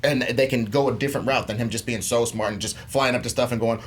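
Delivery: ordinary talk, nothing marked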